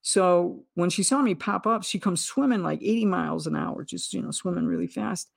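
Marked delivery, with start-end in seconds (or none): none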